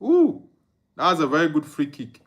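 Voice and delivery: normal talking voice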